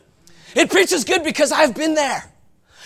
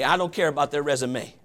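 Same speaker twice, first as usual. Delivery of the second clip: muttered dismissal; 11 semitones lower, 10 dB quieter than usual. Real, not acted. Preacher dismissing complaints.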